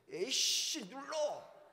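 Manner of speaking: loud, high curse and order; angry outburst. Retold or imitated. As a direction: spit it out like a hothead. Hothead presses the bomb button.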